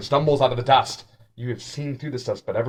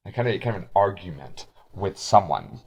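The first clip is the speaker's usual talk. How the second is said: usual speaking voice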